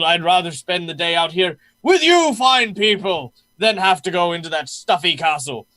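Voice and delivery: hearty, effusive, bursts of high loud delight; jovial guard captain. Acted. Jovial captain tells guests he loves the celebration, effusive. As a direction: gush like a merry feast host.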